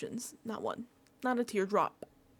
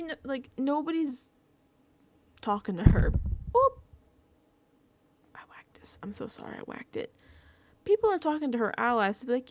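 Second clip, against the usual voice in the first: usual speaking voice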